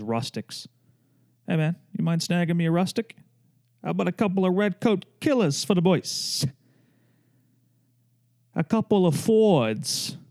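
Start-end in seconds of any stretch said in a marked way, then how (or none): 1.5-10.2 light mock bro-voice asking for beers; hammed-up casual bro, slowing on Fords; bar buddy voice. Retelling his beer-order joke to friends, mild bro voice. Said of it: "I said that last night".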